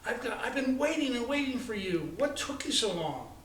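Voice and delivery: put-on high, eager girl's impatient chatter; excited. A narrator's reading. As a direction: gush it out, impatient to get going. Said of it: "with excitement".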